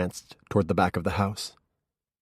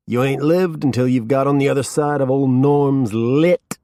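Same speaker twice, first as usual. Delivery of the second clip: higher, louder, slow stoner swagger; drunk stoner boast; 7 semitones higher, 6 dB louder than usual. A narrator's reading. Drunk Norman pushing a drink on the detective, loud stoner swagger. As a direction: brag about the booze, loose and loud.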